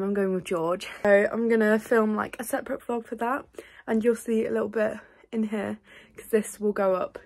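ordinary talk

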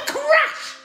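high squealing laugh on crash; squealed crash. Acted. Sound effect in song.

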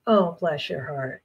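soft, slow, sweetly condescending "Oh, bless your heart."; sugary jab. Shown as a demonstration. Southern woman delivering a sweet insult, slow and soft. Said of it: "but when they say".

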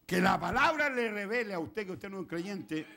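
loud, slow, emphatic preaching; drawn-out loud pulpit delivery; full-force preaching. Real, not acted. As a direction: proclaim it to the congregation with force.

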